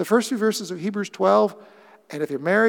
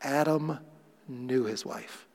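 soft, slow reading; 5 semitones lower, 8 dB quieter, slower than usual. Shown as a demonstration. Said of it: "I like the original Hebrew".

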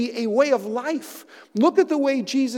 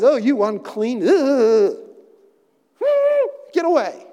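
mock disgust; 3 semitones higher, 4 dB louder than usual. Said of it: "Jesus doesn't look at him".

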